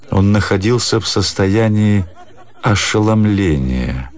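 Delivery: usual speaking voice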